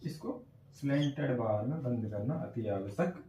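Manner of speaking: normal talking voice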